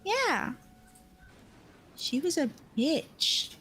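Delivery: put-on high, slow kid's blunt remark; cheeky child. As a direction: say it like a cheeky little kid.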